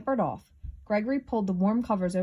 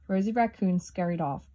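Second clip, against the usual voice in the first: usual speaking voice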